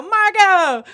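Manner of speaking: loud, high name announcement; eager blurt. Retold or imitated. Contestant answering the group interviewer, over-eager shout. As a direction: blurt it out far too eagerly.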